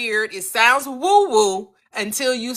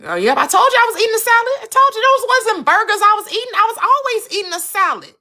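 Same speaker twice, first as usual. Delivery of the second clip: pitched-up playful boasting; high triumphant bragging; 10 semitones higher, faster than usual. Pretend. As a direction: crow that you were right. Friend's triumphant reply.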